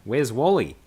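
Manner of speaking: loud, high, exaggerated Australian accent; hammed-up Australian accent. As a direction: belt it out like a cartoon Aussie. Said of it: "That sounds Australian".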